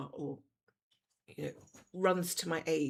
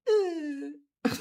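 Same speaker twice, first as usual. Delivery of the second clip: high, loud groaning "uh"; imitated human-like moan; 9 semitones higher, 10 dB louder than usual. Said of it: "It's more like a".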